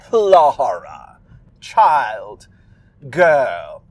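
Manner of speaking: "Lara" with an extra breathy syllable, "La-hara"; loud, drawn-out single words, each falling away; imperious old witch summoning a girl; Old Widow Holcomb. Acted. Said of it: "I think that there's an extra syllable".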